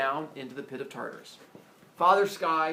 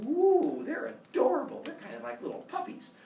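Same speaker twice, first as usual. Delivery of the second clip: high-pitched gushing coo; smitten cooing; 9 semitones higher than usual. Acted. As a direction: coo as if over puppies. Father Sky melts at the sight.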